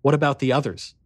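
usual speaking voice

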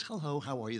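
softly imitated sweet woman; very quiet, dainty greeting, lightly put on; sweet little voice. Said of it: "she has this sweet little voice".

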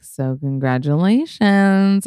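drawn-out, rising congratulations with a hint in it; knowing congratulations. Retold or imitated. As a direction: imitate a sly, winking congratulations. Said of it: "she, like, winks at me".

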